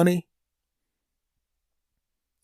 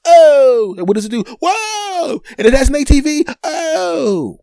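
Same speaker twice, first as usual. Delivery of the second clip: high, loud, ever more amazed exclamations; mock amazed crowd; 10 semitones higher, 9 dB louder than usual. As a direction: ham up the crowd's rising wonder. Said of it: "the same crowd that was going".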